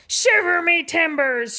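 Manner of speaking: pirate shout. Acted. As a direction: roar it like an outraged captain. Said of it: "shouted Pete".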